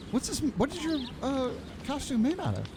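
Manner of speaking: normal talking voice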